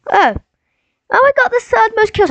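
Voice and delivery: high-pitched mock of an opponent; mocked other player. Gamer imitating the player he sneaks up on, high mocking voice. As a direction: put on a high voice to mimic him. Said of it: "then he'd just be like".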